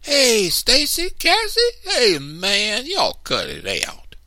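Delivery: high, loud, slow calling out; a boy calling for siblings hiding from him. A narrator's reading. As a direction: call around for the hidden kids, fed up.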